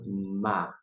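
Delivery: ordinary delivery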